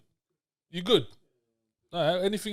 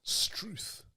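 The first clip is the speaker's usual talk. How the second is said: put-on Australian accent; 3 semitones higher, 9 dB quieter than usual. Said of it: "No, that's Australian".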